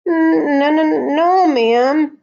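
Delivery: high, loud, stammered denial; nervous stutter. A narrator's reading. Liza answers her teacher after screeching, high nervous stutter. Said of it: "Liza stuttered".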